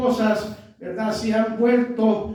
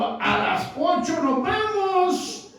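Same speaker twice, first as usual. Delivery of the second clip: stern reminder; 7 semitones higher, slower than usual. Retold or imitated. Pastor quoting himself to wife.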